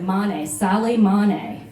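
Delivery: Mane, Saleh Mane said very slowly and deliberately; answering nervously with the name; slow, careful answer. Retold or imitated. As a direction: spell out the name slowly, falling at the end. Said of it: "And I said".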